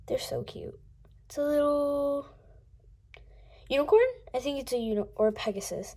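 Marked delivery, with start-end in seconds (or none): 0.1-1.4 whisper. Real, not acted. Cooing over toys.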